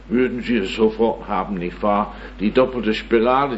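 ordinary delivery

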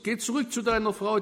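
ordinary talk, nothing marked

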